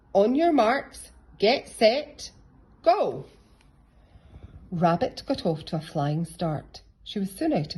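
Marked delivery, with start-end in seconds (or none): 0.1-3.3 loud, high, slow countdown; race starter call. A narrator's reading. Teacher starting the long distance race with a loud call.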